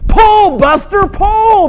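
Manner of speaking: high, loud shouted command; yelled out high like a farmer; farmer's yell. Acted. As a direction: holler at a horse to get it pulling. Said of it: "the farmer yelled".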